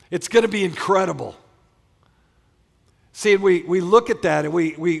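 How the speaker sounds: loud, high-pitched preacher; rousing pulpit call to the congregation; fired-up preaching. Real, not acted. Preaches at full force.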